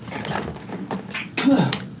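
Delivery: high rasping cough that won't stop; coughing fit. Real, not acted. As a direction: choke and cough through the words. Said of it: "I can't stop coughing".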